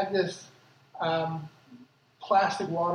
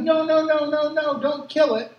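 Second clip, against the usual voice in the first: high, loud, rapid-fire protest; urgent high-pitched shout, acting out the field agent; imitated panicked yell; 7 semitones higher, 6 dB louder than usual. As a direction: yell it out to stop him in his tracks. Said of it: "starts yelling".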